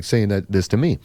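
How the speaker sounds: ordinary delivery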